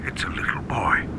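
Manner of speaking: hushed, awed whisper. Acted. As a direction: whisper in amazement. Whispering about the boy.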